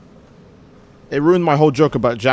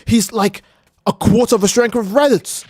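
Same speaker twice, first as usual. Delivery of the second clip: mock astonishment, pitch climbing as it goes; a touch of hammed-up awe; Gohan, mildly amazed; 7 semitones higher than usual. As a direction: act lightly stunned, rising higher. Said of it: "senses his ki and goes".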